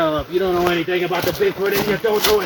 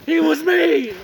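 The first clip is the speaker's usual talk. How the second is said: angry; 10 semitones higher than usual. Acted. Gage's rant about Sasquatch.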